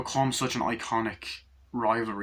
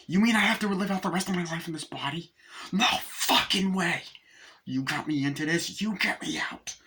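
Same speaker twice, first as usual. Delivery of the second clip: Chucky voice; 7 semitones higher than usual. Retold or imitated. Chucky panicking at the news.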